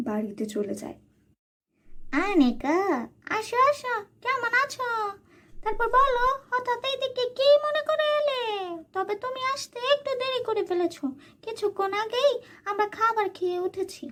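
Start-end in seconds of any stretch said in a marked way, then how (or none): 2.3-14.0 high-pitched sweet-talking aunt; high, sly aunt welcoming her niece. Acted. Aunt greets Anika.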